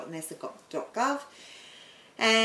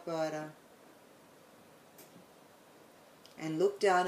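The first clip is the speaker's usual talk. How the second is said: guided meditation; 4 dB quieter than usual. Acted. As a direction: guide them calmly into the light.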